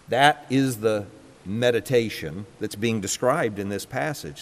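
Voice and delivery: ordinary delivery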